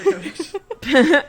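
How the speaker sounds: laughing. Real, not acted.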